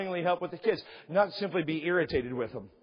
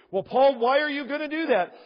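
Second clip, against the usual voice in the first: questioning listener; 7 semitones higher, 4 dB louder than usual. Acted. Imagined questioner asks Paul.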